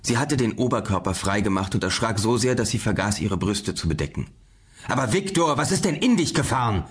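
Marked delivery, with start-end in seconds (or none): 4.9-6.8 raised, higher-pitched exclamation; alarmed call, pitched up; calling after him. A narrator's reading. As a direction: call out in startled reproach after someone rushing off. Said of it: "rief Doktor Grohlke hinter ihm her".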